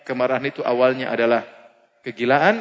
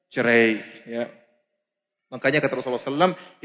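normal talking voice

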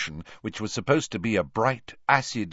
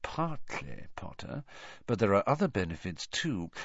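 soft sly whisper; 2 semitones higher, 6 dB quieter than usual. Acted. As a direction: lean in and whisper it. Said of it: "lowering his voice to a whisper".

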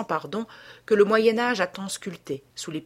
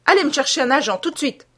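loud, high urgent command; shouted order; 7 semitones higher, 6 dB louder, faster than usual. A narrator's reading. Chaumontel sends Baptiste for a policeman, shouting. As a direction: call out an order, raised and higher. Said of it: "cria Chaumontel".

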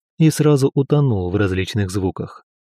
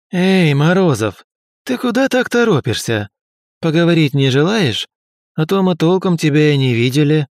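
mocking bully; 5 semitones higher than usual. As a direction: taunt him from across the hall. Bully taunts hero in corridor.

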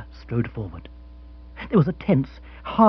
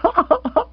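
hearty guffaw; 4 dB louder than usual. Acted. Rory laughs after story.

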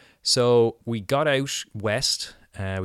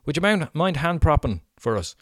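raised, higher voice calling to a colleague; calling out across the apron; 7 semitones higher, 6 dB louder than usual. Retold or imitated. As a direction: shout the request over to Trevor, pitched up. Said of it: "he shouts out to him and goes".

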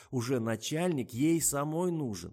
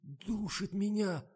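very high, slow strangled voice; choking, squeezed out; 9 semitones higher, much slower than usual. A narrator's reading. Tasya clutches her throat after the syrup, choking. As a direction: strain it out as if choking. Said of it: "выдавила она еле-еле".

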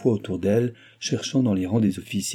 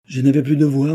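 high, loud, agitated plea; emphatic protest; 6 semitones higher, 7 dB louder than usual. A narrator's reading. Son excusing his silence to his father, agitated. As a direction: protest with raised voice.

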